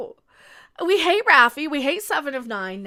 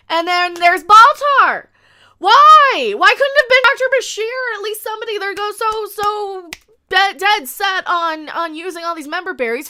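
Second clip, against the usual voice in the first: louder, indignant disbelief; loud exasperated rant; 4 semitones higher, 10 dB louder than usual. Real, not acted. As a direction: vent the outrage at full volume. Outraged rant about casting.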